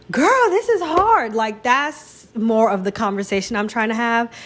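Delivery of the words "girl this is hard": honest complaint. Retold or imitated. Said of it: "it's like".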